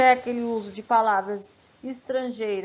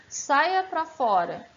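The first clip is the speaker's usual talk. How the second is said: mock example phrase; 5 semitones higher, 3 dB louder, much slower than usual. Shown as a demonstration. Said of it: "o pleonasmo vicioso é aquele quando eu digo".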